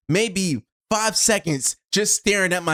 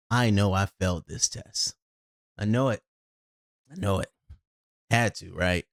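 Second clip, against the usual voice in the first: defeated; 10 semitones lower, 4 dB quieter than usual. Retold or imitated. Resigned after hearing time.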